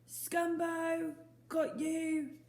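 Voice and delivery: high, loud call from far off; distant yell. Acted. Boy in the wood catching Scumbo, yelling from afar. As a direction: shout it across the woods.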